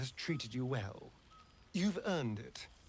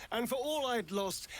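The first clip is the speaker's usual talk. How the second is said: normal talking voice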